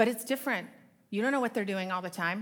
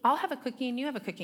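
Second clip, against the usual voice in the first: put-on sweet kids; 6 semitones higher, faster than usual. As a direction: imitate overly sweet polite children, much higher. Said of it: "they all spoke so sweetly to each other".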